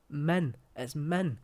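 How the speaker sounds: "Min" with a short i in place of a; Scots pronunciation of man. Shown as a demonstration. Correcting how man is said in Scots, emphatic short-vowelled "min". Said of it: "It's no man or mon".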